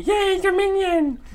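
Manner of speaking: squeaky high mock cheer. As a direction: chirp it like a sarcastic cheer. Mock cheer mid reading.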